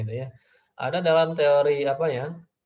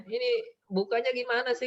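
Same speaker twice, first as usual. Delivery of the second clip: put-on high-pitched child voice; child asking for help; 10 semitones higher than usual. Child asks mother how to open it, high imitated voice.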